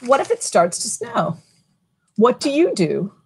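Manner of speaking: lilting picture-book read-aloud; reading to children. A narrator's reading. Librarian reading a snow book to kids, lilting. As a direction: read it to a room of little kids.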